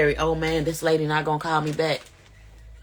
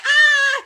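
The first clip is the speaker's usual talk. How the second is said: high loud scream; high shriek of fright; retold scream; 5 dB louder than usual. Screaming on seeing the ex.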